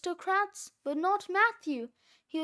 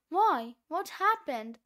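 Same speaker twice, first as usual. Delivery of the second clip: usual speaking voice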